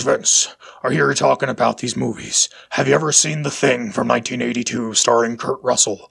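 low-pitched impression of a history teacher; deep-voiced teacher. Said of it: "he had this very deep voice".

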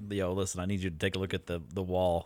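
hushed. Retold or imitated. Retold text to wife, secretive.

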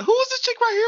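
a high, mocking take on a confused viewer; mock guy asking. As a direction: squeak it out like a baffled dude. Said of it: "dude was like".

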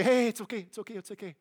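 soft, fading hush of reassurance; soothing a panicked child. Retold or imitated. Parent to a panicked child, soothing and fading to a hush. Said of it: "Let him say".

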